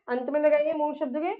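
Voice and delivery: ordinary talk, nothing marked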